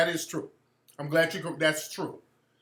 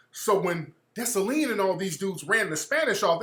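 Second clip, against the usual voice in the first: usual speaking voice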